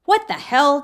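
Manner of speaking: loud, high, incredulous shout; furious demand at the woman gripping him; angry outburst. Acted. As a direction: yell it, outraged and confused. Driver protests wrist being held.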